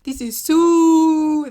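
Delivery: loud, slow growling bear. Acted. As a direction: boom it out like a bear. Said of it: "this is a bear voice".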